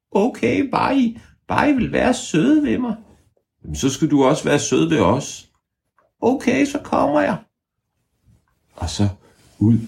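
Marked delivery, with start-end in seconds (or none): 0.1-7.4 very high squeaky creature voice; tiny high creature voice; ævle bævle voice. Acted. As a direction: squeak back like a cheeky hidden creature.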